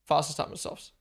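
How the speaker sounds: ordinary talk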